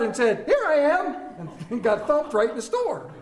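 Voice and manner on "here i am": higher and slow, laughing; mock child reveal. Son popping out from the clothes rack to his mother, higher and laughing. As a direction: sing it out like a proud kid. Hiding son pops out in store.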